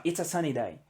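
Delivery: "It's a sunny day" run together, the contraction spoken naturally; contracted form. Shown as a demonstration. Teacher modelling "It's a sunny day" for Brazilian students, natural contraction. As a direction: show the student how "It's a sunny day" sounds in real speech. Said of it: "a gente fala assim, ó".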